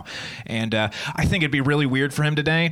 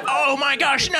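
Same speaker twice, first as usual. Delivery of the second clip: mock dismay; 14 semitones higher than usual. Comedian reliving a tragic montage, mock-panicked wail. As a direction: cry out in overblown horror.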